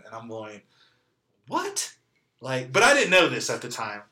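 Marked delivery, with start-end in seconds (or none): 1.5-1.9 very high-pitched retold "what"; squeaked, acted-out disbelief; disbelieving. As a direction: voice shoots up in mock shock.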